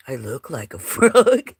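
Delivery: loud laugh breaking into "frog". Real, not acted. Laughs at her photo.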